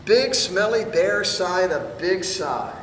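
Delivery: usual speaking voice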